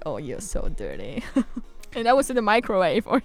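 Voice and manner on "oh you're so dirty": grandma quote. Retold or imitated.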